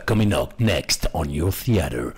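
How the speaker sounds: deep English trailer announcer; low, dramatic coming-soon voice; movie trailer voice. Shown as a demonstration. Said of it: "ce genre de voix un peu grave". (voix grave de bande-annonce)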